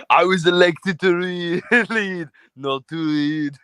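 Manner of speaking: put-on slow Austrian accent breaking into chuckles; amused strongman impression; Arnie. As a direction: declare like an Austrian politician. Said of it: "can you do the audio impression?".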